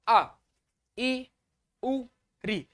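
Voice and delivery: short clipped vowels आ ई उ री; short vowel demo. Shown as a demonstration. Teacher demonstrating short vowels to students. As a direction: say आ, ई, उ, री each short and quick. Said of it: "जब इनको बोलेंगे".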